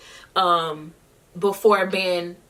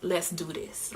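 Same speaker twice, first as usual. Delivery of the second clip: quiet resolve; 2 semitones lower, 12 dB quieter than usual. Retold or imitated. Singer settling her nerves before the show, quiet resolve. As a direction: say it softly, psyching yourself up. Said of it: "I kinda shifted into a".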